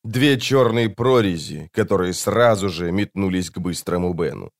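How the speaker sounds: ordinary delivery, nothing marked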